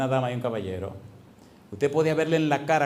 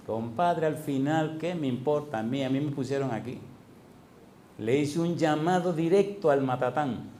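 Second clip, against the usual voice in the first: normal talking voice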